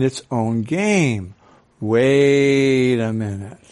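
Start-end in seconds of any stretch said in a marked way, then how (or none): 1.8-3.6 slow realization. Pretend. Voiced realization.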